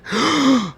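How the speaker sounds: sharp high mock gasp of shock. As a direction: gasp as if the news just hit. Moon base goes dead.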